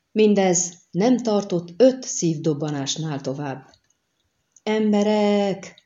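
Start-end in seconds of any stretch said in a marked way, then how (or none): 4.6-5.8 long drawn-out, higher-pitched call; shouted alarm. A narrator's reading. Miner raising the alarm among the buildings, crying out. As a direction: call the villagers out, stretching the word. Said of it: "ordított fel valaki az épületek között".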